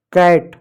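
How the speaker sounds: "कैट" with the open AI vowel. Shown as a demonstration. Teacher answering own question on cat, "कैट" with open AI. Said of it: "उसको ऐ की मात्रा पढ़ रहे हो".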